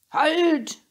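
high, loud shout of halt. A narrator's reading. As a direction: yell stop at the fox. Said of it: "hat Emma geschrien".

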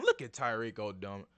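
ordinary talk

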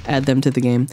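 ordinary talk

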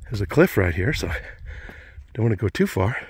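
usual speaking voice

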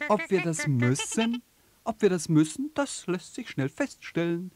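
hoch und keck gestellt; frech. Acted. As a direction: frech zurückgeben, wie ein Spatz. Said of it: "sagt der Spatz ganz frech". (frecher Spatz, hoch gesprochen)

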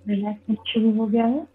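unhurried meditation guide, long pauses between phrases; calm instructions with room to breathe; guided meditation. Real, not acted. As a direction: lead them gently into relaxation, phrase by phrase.

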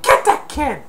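high, loud, cartoon-villain yelling; angry burglar. Burglar raging at Kevin, high mock yell. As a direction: bellow threats at the kid. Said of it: "And he said".

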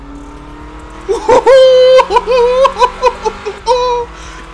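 long squealing laugh; helpless high laugh. Real, not acted. Gamer losing it after crash, helpless laugh. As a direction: crack up till it hurts.

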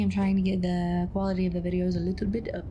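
ordinary delivery

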